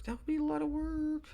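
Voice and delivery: high put-on voice. Shown as a demonstration. Podcaster to listeners, replaying a line in a high voice. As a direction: toy with the phrase in a high voice. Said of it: "repeats the line in a new voice".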